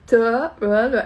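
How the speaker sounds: loud nonsense syllables, botching a repeat-after-me; Joey's garbled French. Retold or imitated. Joey repeating after Phoebe's French lesson, garbled gibberish. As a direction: imitate a hopeless pupil confidently mangling the sounds. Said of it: "he goes like".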